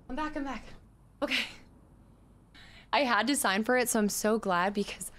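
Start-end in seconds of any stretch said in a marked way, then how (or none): 0.1-1.4 breathless after rushing back; panting between words; out of breath. Real, not acted. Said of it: "I'm out of breath".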